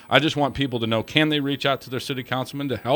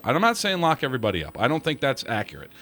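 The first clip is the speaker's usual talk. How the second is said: ordinary talk, nothing marked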